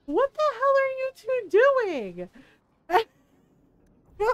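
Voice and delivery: high, slow, stretched-out exclaim; daughter's disbelief. Retold or imitated. Daughter catching parents nomming, high slow disbelief. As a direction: draw it out way up in mock outrage. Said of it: "she goes".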